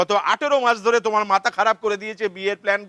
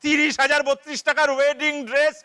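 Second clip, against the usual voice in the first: shrieks high, then keeps shouting higher; yelling at daughter; 6 semitones higher than usual. Acted. Father yells at his daughter locked in the bathroom, high and shouting. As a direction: scold her through the door, pitched way up.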